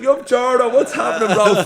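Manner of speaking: loud, high-pitched hail to a friend; hyped calling out. Retold or imitated. Man spots friend in vaccine queue, excited shout. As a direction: holler across a busy room. Hailing acquaintance in retold queue.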